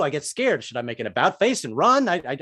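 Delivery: usual speaking voice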